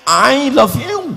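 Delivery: slow, laughing I love you; mock English. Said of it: "tidak ada dalam bahasa Inggris huruf ain". (logat Inggris pelan sambil tertawa)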